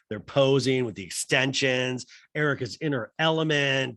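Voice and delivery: normal talking voice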